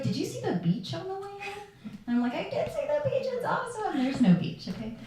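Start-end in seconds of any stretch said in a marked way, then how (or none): 0.0-1.9 dad's confused question. Retold or imitated. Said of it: "he's like". 2.3-4.0 soft humoring. Retold or imitated. Humoring confused dad gently.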